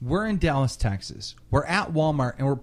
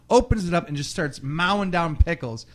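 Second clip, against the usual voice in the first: ordinary talk, nothing marked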